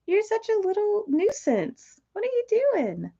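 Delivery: very high, softened pet-talk. Real, not acted. Owner fondly scolds pet bird, high baby talk.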